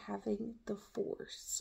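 breathy whisper. Pretend. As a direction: whisper it like a secret. Whispered aside about the force.

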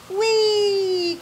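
high, loud squeal of joy; gleeful cry. Acted. Bob racing horses through the valley, whooping. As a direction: whoop like a kid on a fast horse. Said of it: "cried Bob".

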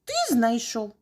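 loud, eager mouse answer; loud shouting. A narrator's reading. Mice answer the rooster, shouting loudly. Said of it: "голосно закричали мишенята".

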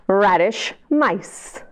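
ordinary delivery, nothing marked

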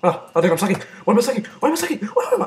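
rising frantic yelps. Real, not acted. Frustrated while losing a fight.

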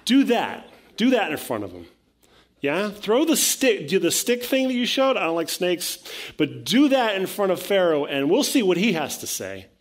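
hammed-up crowd cheering on their champion; eager Israelites. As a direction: egg Moses on, loud and high. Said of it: "they send Moses and Aaron".

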